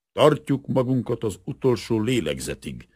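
a hint of a crackly old soldier; old warrior's crackling voice. A narrator's reading. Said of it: "az öreg harcos recsegő hangját".

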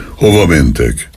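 plain reading voice

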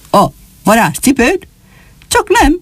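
high-pitched, incredulous questioning; astonished exclamation. A narrator's reading. Old shoemaker hearing of the magic shoe, astonished. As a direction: exclaim in surprise, leaping up in pitch. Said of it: "úgy kiáltott fel a meglepetéstől".

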